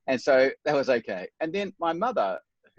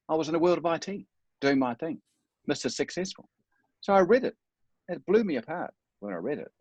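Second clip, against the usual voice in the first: ordinary delivery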